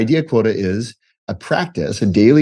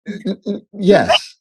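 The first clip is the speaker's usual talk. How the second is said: stammered hesitation; 10 semitones higher, slower than usual. Retold or imitated. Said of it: "And I go".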